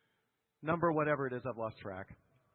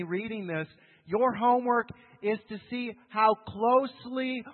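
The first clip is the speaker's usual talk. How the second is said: louder, raised preacher voice, slowing on key words; preaching at full force; 4 semitones higher, 10 dB louder than usual. Acted. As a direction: drive each point home. Reads stress list to congregation.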